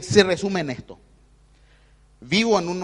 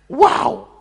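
a high, loud burst of wonder; amazed wow; 11 semitones higher, 7 dB louder than usual. Shown as a demonstration. Preacher bursts out in wonder at the affirmation, high and loud. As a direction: gasp it out like a revelation. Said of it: "exclaims after affirmation".

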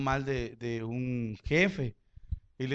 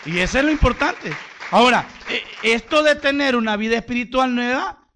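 preaching at full force; 9 semitones higher, 11 dB louder than usual. Acted. Preacher reading John 3:36 to the church, loud preaching. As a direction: thunder the verse at them.

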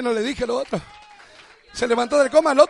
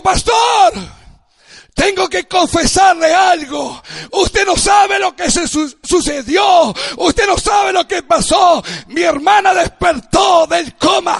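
bursting with joy, louder and higher; retold joyful news; 5 semitones higher, 7 dB louder, slower than usual. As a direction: deliver the miracle news breathless with joy. Brother's joyful phone call retold.

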